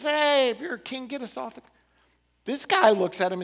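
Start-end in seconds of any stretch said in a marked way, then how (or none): 0.0-1.6 mocking taunt. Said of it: "making fun of Jesus".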